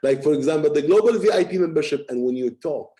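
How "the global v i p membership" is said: "global V I P membership" higher, louder and fast; emphatic. Shown as a demonstration. Coach giving an example of emphasis, "global V I P membership" punched high. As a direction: push up "global V I P membership" with force. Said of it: "sometimes you need to emphasize a word".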